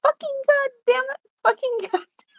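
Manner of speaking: put-on very high baby voice swearing; cursing baby. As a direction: imitate a tiny cursing toddler. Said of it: "and she's like".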